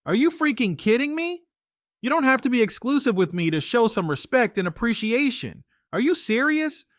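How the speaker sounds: high, indignant outburst; furious, incredulous demands; angry. A narrator's reading. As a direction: pitch shoots up in disbelief and anger.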